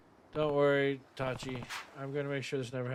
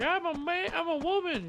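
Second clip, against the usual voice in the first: much higher put-on mock voice; mocking falsetto; 3 dB louder than usual. Streamer mocking the enemy's line, high falsetto mock voice. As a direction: squeak it out like a silly impression. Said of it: "He's like".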